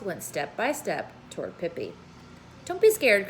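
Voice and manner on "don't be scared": higher, louder teasing call; playful reassurance shouted across the roof; cheerful cry. Acted. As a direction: call out to the nervous policemen. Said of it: "cried Pippi".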